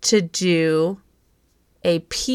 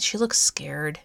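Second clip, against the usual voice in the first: usual speaking voice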